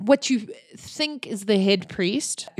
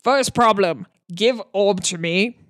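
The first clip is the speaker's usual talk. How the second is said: orc broken speech. Acted. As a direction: count them off like a blunt brute. Orc listing problems in broken speech.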